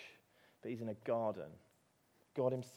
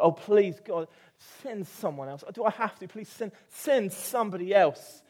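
louder, higher begging; pleading Moses; 8 semitones higher, 12 dB louder than usual. Retold or imitated. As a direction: plead with God not to be sent. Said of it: "he's pleading with God".

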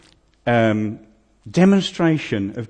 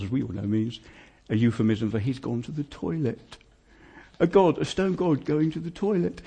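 ordinary talk

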